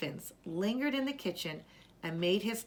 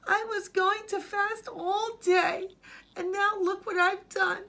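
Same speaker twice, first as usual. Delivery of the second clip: tearful; 13 semitones higher, 5 dB louder than usual. Acted. Boy cries over his broken fast, high and tearful. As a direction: voice the boy on the verge of tears. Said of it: "tears began to stream".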